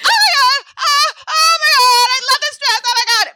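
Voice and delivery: very high, loud shriek; hammed-up horrified screaming; shocked wailing. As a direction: squeal in mock anguish over the ruined dress. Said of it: "I was in so much shock".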